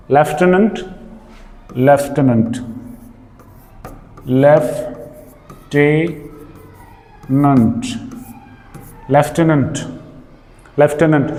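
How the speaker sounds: "लेफ्टिनेंट" said carefully as LEF-ti-nent; drawn-out teacher demo of "लेफ्टिनेंट"; lieutenant as leftinent. Shown as a demonstration. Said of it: "ये जो word है".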